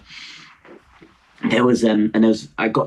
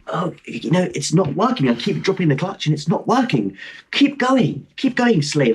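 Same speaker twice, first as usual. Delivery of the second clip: mock slave driver; 6 semitones higher than usual. Mock bossing the old men.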